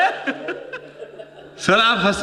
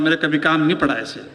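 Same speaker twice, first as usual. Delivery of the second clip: ordinary talk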